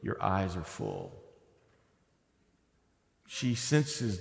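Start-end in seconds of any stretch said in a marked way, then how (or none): 0.0-1.1 softly, a concerned assistant noticing; hushed, caring observation; quiet gentle remark. Retold or imitated. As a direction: say it softer, a quiet aside. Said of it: "she goes".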